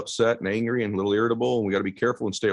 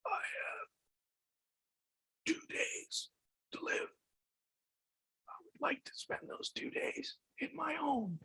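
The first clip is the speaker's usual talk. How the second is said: breathless, toneless rasping whisper; voicing his dying father, almost no breath; dying father's faint voice; 13 dB quieter, slower than usual. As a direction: barely get the words out, as if with no air. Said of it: "my dad sounded more like this".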